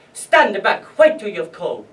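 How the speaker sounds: hard, loud acted order; shouted command. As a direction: shout them back into line. Officer shouting prisoner back.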